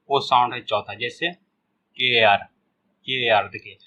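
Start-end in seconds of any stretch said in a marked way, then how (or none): 1.3-2.4 'केयर' very slowly, gliding from e into a; combined vowel demo. Shown as a demonstration. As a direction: stretch both vowels in 'केयर'. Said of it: "ए और ओ मिला के जो sound निकलता है". ('केयर' के दोनों स्वर खींचकर बोलें)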